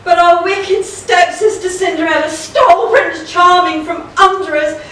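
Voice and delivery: milk the tragedy for the audience; hammed-up panto lament.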